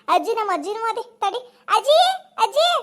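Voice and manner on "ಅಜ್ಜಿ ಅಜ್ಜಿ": calling out. Acted. Child calls grandmother loudly.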